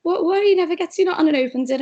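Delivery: stunned that dad remembered; retold surprise. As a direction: daughter amazed at dad's gift, excited. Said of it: "I was like".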